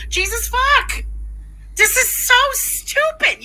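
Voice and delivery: high-pitched exasperated outburst; exasperated disbelief. Real, not acted. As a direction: throw it out in sheer exasperation. Exasperated at the man's mistake.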